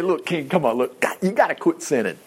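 low, softened plea to a king; pleading. Acted. Daniel begging the king to quit sinning, pleading. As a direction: plead gently, voice dropped and soft. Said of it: "he's pleading. Daniel's saying".

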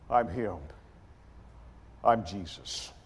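low, quiet self-identification; calm declaration. Retold or imitated. Jesus answering the crowd a second time, calm.

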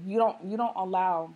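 ordinary delivery

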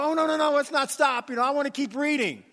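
put-on a boy begging his mom to keep reading, eager; eager pleading.

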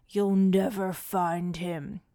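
slow, measured thief's voice; defiant thief. A narrator's reading. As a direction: say it slowly as a caught villain. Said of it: "yelled the tall thief".

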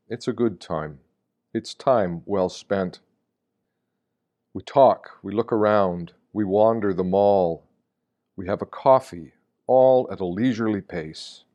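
ordinary talk

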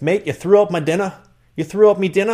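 high, upset mock Irish roommate; Irish accent. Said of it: "work on your Irish accent".

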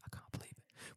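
stunned whisper. Real, not acted. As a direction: breathe it out in awe. Stunned by the news.

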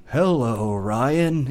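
put-on low, soft old dog voice.

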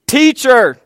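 sudden loud high cry of teacher; shouted call. Acted. Martha calls out to her sister, loud.

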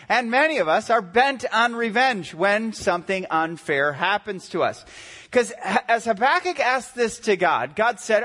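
ordinary talk

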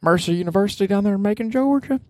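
hint of a southern accent. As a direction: lay on the Georgia twang. Southern accent noted by Granny.

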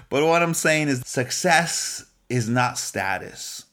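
slow, deliberate, each phrase weighed; slow emphatic preaching. Real, not acted. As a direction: preach it slowly, word by word. Sermon climax, slow emphatic.

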